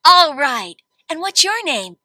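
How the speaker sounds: normal talking voice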